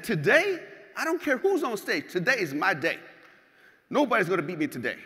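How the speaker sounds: loud, emphatic retold declaration; defiant boast. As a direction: declare victory to doubters, loud and certain. Acts out his defiant boast.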